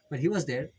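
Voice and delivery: ordinary talk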